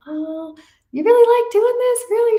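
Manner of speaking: amazed disbelief. Retold or imitated. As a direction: gush in disbelief at the nurse. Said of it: "I said".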